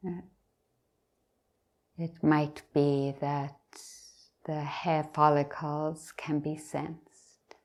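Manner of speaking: unhurried meditation guidance with long pauses; guided meditation. Real, not acted. Teacher leading meditators from the top of the head down, guided meditation. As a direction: guide them gently, leaving long silences between phrases.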